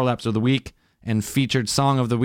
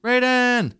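high shouted call; 13 semitones higher than usual. Real, not acted. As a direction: yell his name across. Calling out to lost co-host.

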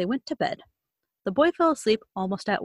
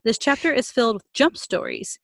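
usual speaking voice

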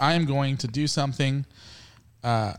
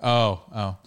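ordinary talk

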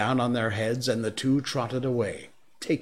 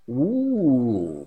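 startled ooh; 3 semitones higher, 5 dB louder than usual. Acted. Scooped up by the bear.

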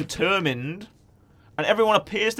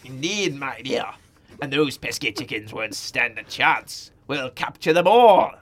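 slow villain voice; 5 semitones lower than usual. Acted.

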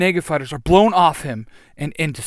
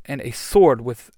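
usual speaking voice

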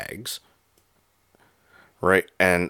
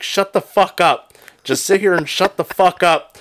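angry yell; 9 semitones higher, 3 dB louder than usual. Retold or imitated. Yelling at drunk friend.

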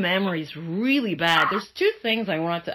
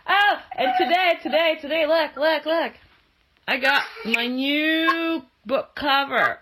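excited; 9 semitones higher, 4 dB louder than usual. Real, not acted. Showing off new book cover.

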